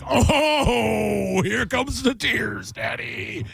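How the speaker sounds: high, stretched mock weeping, slowing down; mock tearful dad. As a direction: ham up choking on tears. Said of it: "And I'm like".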